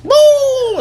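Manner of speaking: very high, loud whooping "boo"; imitating a drawn-out holler; startling boo. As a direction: whoop it out in falsetto. Startling the called turkeys.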